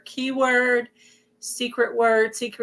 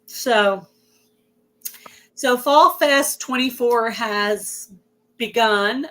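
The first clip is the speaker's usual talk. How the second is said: ordinary talk, nothing marked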